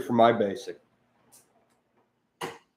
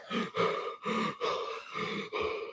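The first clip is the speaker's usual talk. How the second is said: panting; 12 semitones higher, 12 dB quieter than usual. Retold or imitated. Soldier on a twelve K march, heavy open-mouth panting. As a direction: huff like a tired soldier on a long march. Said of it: "You're panting".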